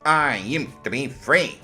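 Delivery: high, slow whiny imitation; mocking echo. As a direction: whine it back at her mockingly. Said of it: "said the woman".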